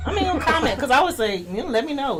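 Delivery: laughing. Real, not acted. Laughing plea to the viewers.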